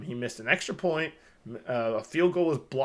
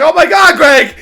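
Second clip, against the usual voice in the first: very high, full-volume yell; re-enacted astonished shout; 13 semitones higher, 19 dB louder than usual. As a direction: scream it in stunned excitement. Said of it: "I was thinking the same thing".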